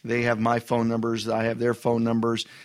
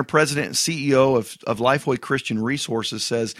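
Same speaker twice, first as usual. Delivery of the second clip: ordinary talk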